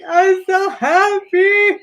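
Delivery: high, slow, tearful "so happy"; mock tearful joy; happy crying. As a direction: imitate sobbing with joy. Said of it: "Like, I was just crying".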